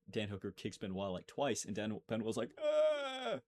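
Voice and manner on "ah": high yelp of pain, French fighter; mock pain yelp. Mocking a French fighter's high yelp. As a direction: squeal like you've just been kicked. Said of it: "that's what French people do when they're in pain".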